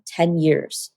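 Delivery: ordinary talk, nothing marked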